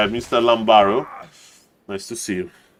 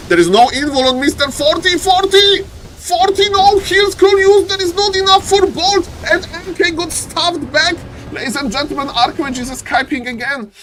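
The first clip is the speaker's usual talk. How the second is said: high-pitched esports commentary at a frantic peak; excited play-by-play. Real, not acted. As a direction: call the climax of the match in a high, urgent voice.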